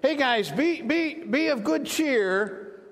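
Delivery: high, drawn-out call of encouragement; voicing Jesus. Jesus to the troubled disciples in the boat, calling encouragement. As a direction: call out to hearten frightened friends. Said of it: "Jesus spoke to them, saying".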